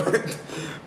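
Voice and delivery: soft breathy giggle; short held-in laugh; quiet giggle. Real, not acted. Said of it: "I'm trying not to laugh".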